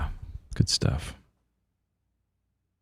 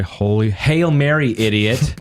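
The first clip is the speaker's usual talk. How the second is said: mock angry kid; 2 semitones higher, slower than usual. Kids getting angry with each other mid-prayer, mock angry. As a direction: pray it like an insult, slow and raised. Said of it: "kids getting angry with each other".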